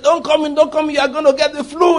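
high, agitated quoted cry; urgent warning. Retold or imitated. People at the door warn the visiting preacher off, urgent and high. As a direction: blurt it out to keep someone out. Voices alarmed people refusing entry.

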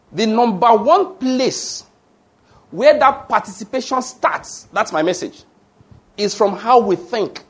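fervent sermon voice, high and slow; preaching at full force. Acted. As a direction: preach it out, drawing each phrase long. Pastor preaching to congregation.

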